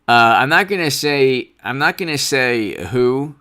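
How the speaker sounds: ordinary talk, nothing marked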